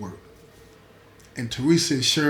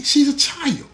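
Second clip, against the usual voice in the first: much higher, near-crying outrage; pushed up high; 11 semitones higher, 3 dB louder than usual. Acted. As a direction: climb to a pleading peak on child. Repeated, pushed even higher.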